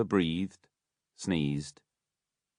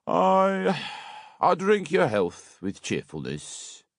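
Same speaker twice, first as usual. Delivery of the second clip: much higher, slow, grandly hammed toast; pompous beadle toast; 12 semitones higher, slower than usual. A narrator's reading. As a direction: raise the glass pompously. Bumble toasting.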